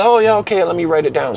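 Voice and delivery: high, brisk polite agreement; a hint of a breezy Irishman; lightly voiced Irish guy. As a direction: humour them, jotting it down.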